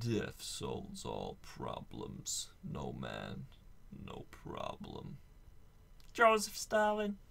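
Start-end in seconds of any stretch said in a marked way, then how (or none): 0.0-5.2 low, slow menacing quote; very low and slow, ominous; deep dramatic voice. Acted. Quoting Stalin in deep voice.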